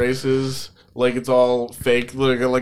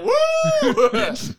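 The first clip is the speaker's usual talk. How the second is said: cheering shout. Pretend. Crowd cheering.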